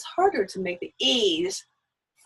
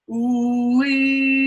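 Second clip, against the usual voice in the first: tongue-forward ooh-wee; 3 semitones higher, 7 dB louder than usual. Shown as a demonstration. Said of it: "With it here".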